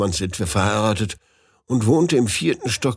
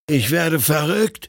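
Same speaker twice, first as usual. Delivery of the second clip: higher, slow, aghast to himself; horrified; 6 semitones higher than usual. A narrator's reading. Narrator horrified at going mad. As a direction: say it as the madness dawns. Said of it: "sagte ich mir entsetzt".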